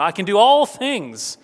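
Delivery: mock boast. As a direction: toss it off like an arrogant boast. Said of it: "as if he was somehow boasting".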